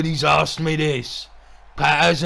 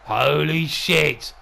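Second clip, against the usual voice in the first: ordinary delivery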